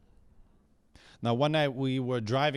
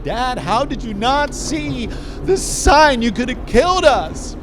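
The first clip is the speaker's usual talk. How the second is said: yelling at dad; 10 semitones higher, 11 dB louder than usual. Retold or imitated. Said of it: "I'm yelling at him like".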